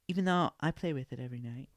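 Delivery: fast, high mock voice; half-whispered joke confession; mock instructor confession. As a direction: sneak in the punchline quickly. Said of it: "That's what she's saying".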